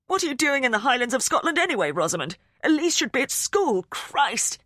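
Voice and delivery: furious father shouting down a bad phone line; angry outburst. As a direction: berate her down the phone.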